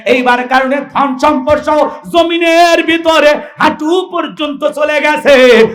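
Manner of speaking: high, ringing sermon storytelling; full-force preaching. Acted. Preacher tells of the prophet's anger, high and impassioned. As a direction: carry the tale out to the crowd.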